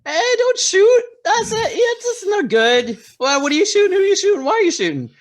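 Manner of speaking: high, loud, frantic pleading to stop the shot; panicked shout. Acted. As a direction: yell at the alien in sudden alarm. Character panics at alien shooting.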